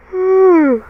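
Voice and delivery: big loud yawn, high and falling; high, noisy yawn; real yawn. Real, not acted. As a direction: let out a real, open yawn. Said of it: "I had to yawn".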